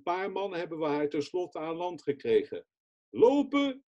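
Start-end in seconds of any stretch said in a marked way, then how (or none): 3.1-3.8 much higher, louder command; calling out. Retold or imitated. Rescuer orders the exhausted woman to walk, high loud call. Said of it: "riep ik".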